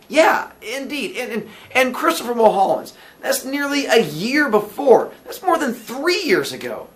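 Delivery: high, loud, emphatic Billy voice; insistent, surprised Billy. Acted. Billy tells landlady how old entries are, insistent. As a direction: pile up the dates, high and emphatic.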